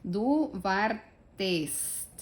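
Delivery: slow, careful German pronunciation; clear German demo. Shown as a demonstration. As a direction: Du wartest spelled out very slowly. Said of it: "ahora van a escuchar por qué". (pronunciación alemana muy lenta)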